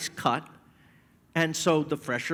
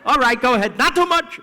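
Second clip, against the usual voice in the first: high, fast, louder mock plea to a stubborn father; hammed-up nagging, pitched up and rushed; retold urging; 12 semitones higher, 7 dB louder, faster than usual.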